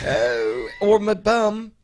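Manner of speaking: high, loud mock British accent. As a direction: chime in with a hammed-up British voice. Joining in the British accent bit.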